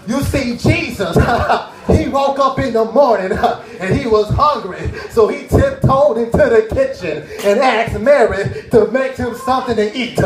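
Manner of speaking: mock preacher sermonizing; imitating his preacher dad. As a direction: ham up a pulpit preacher telling a story. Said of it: "My daddy be up there talking about".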